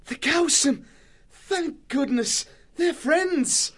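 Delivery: very high, bright cry of relief; relieved joy. A narrator's reading. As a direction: burst out with relief. Matthias relieved at the name.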